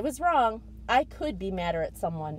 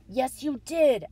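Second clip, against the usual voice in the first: slow, pressed angry hiss; furious hissed retort; 6 semitones higher, 7 dB louder, slower than usual. Acted. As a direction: hiss it through the teeth. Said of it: "I hissed".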